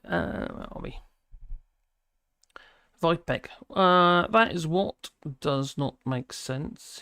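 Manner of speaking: ordinary talk, nothing marked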